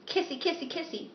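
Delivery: high little singsong chirps; playful kissy talk. Pretend. Playfully voicing stage kiss.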